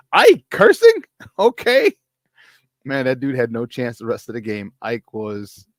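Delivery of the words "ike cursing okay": high, loud shocked reaction; retold astonishment, half laughing; mock disbelief. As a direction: act out stunned amazement, pitched way up. Retells team's shock at cursing.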